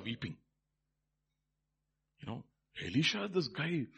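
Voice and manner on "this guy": ordinary talk, nothing marked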